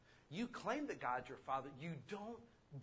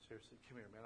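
hushed comfort; 6 semitones lower, 14 dB quieter, faster than usual. Retold or imitated. Husband comforting crying wife.

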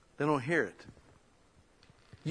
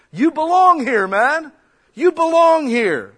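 high, booming shout of welcome; big emphatic cry, much higher and louder; loud exclamation; 11 semitones higher, 11 dB louder, slower than usual. Acted. Acted welcome shouted at the door.

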